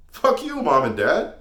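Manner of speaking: high, loud jeer at the parents; loud, soaring taunt; defiant shout. Acted. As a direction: yell it as a cocky retort. Defiant retort to imagined parents.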